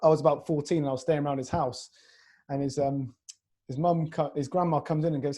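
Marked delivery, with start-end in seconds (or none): none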